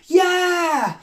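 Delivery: high, drawn-out cheer; demonstrated shout. Shown as a demonstration. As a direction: get the whole crowd cheering.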